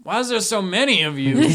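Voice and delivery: put-on high, loud drunken bewilderment; befuddled drunk seeing double; Crazy Eddie. Drunk seeing several of his visitor, loud bewildered voice. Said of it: "Crazy Eddie is the town drunk".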